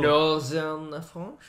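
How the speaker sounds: very slow French-voiced question; slow mock French; French accent. Shown as a demonstration. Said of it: "Your French accent".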